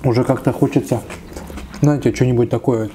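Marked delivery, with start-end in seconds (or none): none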